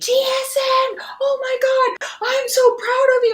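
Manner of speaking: high-pitched shriek of pride; ecstatic, piercing retold scream; excited scream. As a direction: squeal it loud, bursting with pride for a friend. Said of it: "And I screamed".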